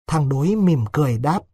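usual speaking voice

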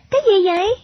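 put-on high-pitched child's question; curious little girl. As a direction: ask eagerly in a high girlish voice. Girl curious about the parcel.

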